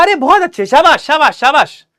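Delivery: high, loud cheering शाबाश; encouraging praise. Acted. Teacher praising the girl's effort, encouraging. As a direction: lift her up with praise. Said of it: "ऐसे थोड़ी शाबाशी दी उसको".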